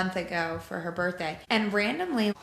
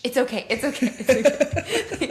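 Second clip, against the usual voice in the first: higher and louder hurried comforting; soothing big sister; 6 semitones higher, 7 dB louder than usual. Retold or imitated. Mom imitating her daughter frantically soothing her crying brother. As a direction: hush the crying baby, urgent and soothing. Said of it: "She's going".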